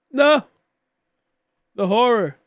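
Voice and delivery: loud, high, hammed-up despair; a theatrical wail of loss; mock dismay. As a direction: cry out in mock horror at the news. Reacting to a shiny Starly.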